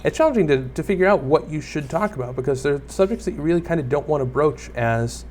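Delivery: usual speaking voice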